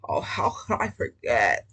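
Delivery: low, mocking put-on nerd voice. Reader mocks the nerd answering Bitsy, low put-on voice. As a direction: sneer it out as the smitten nerd. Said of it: "I know that's how he says it".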